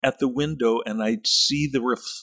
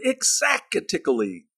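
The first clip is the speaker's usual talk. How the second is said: imitated teacher's shout; 7 semitones higher than usual. Teacher rewarding a good answer, imitated mild shout. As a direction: call it out high, a half-shout. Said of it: "he shouted".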